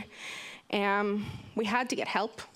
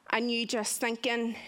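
usual speaking voice

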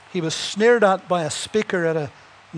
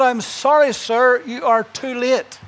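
slow, higher, emphatic preacher's reply; Moody's answer; 4 semitones higher, 3 dB louder, slower than usual. Acted. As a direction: deliver it like a triumphant verdict. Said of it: "Moody said".

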